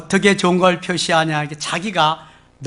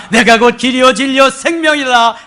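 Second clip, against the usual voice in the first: proclaimed; 6 semitones higher, 7 dB louder than usual. Shown as a demonstration. Proclaimed then repeated softly.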